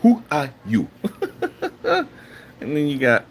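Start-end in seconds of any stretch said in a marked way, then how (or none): 0.0-0.9 hammed-up African accent with swooping pitch; mock African accent. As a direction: put on a measured, emphatic African accent. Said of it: "read it how your picture looks".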